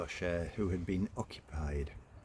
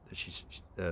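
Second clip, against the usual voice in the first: ordinary delivery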